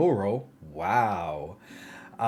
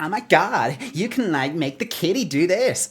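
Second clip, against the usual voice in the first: mocking a bubbly American woman, pitched higher; annoying American woman; 6 semitones higher than usual. Streamer mocks the game's voiceover lady, higher-pitched and gushing. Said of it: "that annoying kind of American, uh, woman voice".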